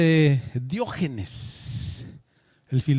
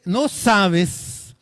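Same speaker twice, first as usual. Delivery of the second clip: loud, higher, indignant demand; angry conqueror; 6 semitones higher, 5 dB louder than usual. Acted. Alexander in a rage at the philosopher, loud and indignant. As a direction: boom it out like an offended emperor. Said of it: "montó en cólera y le dice".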